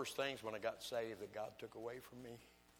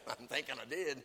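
laughing; 2 semitones higher than usual. Retold or imitated. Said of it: "I said laughs".

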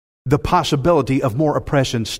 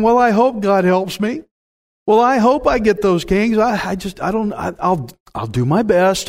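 higher, wavering mock whine; moaning and whining; 5 semitones higher than usual. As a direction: whine it like you doubt yourself. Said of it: "we shouldn't moan and whine".

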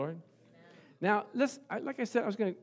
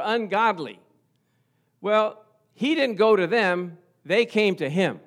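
raised, louder preaching voice; emphatic preaching; 5 semitones higher, 9 dB louder than usual. Acted. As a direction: drive each question home to the congregation. Preaches at full force.

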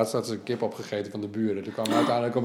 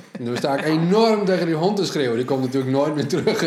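excited retelling; 5 semitones higher, 7 dB louder than usual. Real, not acted. Telling about yelling at dog.

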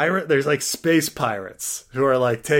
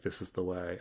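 solemn creed; 6 semitones lower, 13 dB quieter than usual. Pretend. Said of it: "And you'd be like".

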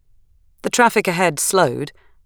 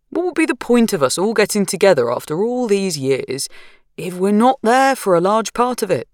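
lightly voiced easygoing husband; calm husband; 6 semitones higher than usual. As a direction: keep it unhurried and mild. Narrator voicing Roshan.

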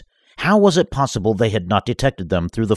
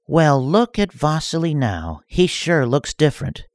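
higher teasing villain voice; mocking villainess; 8 semitones higher than usual. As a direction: taunt him like a crazed dark lady. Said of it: "said Shivillo".

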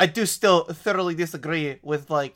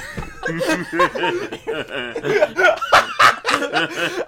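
high giggling fit; real burst of laughter; 12 semitones higher, 7 dB louder than usual. Real, not acted. Player cracking up while admitting to being a chef, laughing. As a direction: break into laughter.

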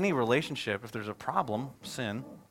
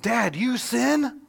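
put-on higher, slow, earnest child's question; little daughter voice; 10 semitones higher, 6 dB louder, much slower than usual. Dad retelling his daughter's serious question, childlike and slow. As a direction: ask it slowly like a stunned small girl. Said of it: "she was totally serious and she said".

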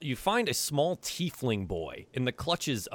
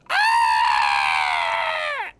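loud shrieking bird cry; bird screech; 7 semitones lower, 8 dB louder than usual. Acted. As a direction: screech at them like a startled bird. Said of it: "lets out a".

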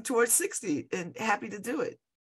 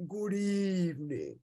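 slow, softer macabre host greeting; Alfred Hitchcock; 7 dB quieter, slower than usual. Retold or imitated. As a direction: greet slowly and softly like a horror-show host. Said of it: "I'm sort of like Alfred Hitchcock-ish".

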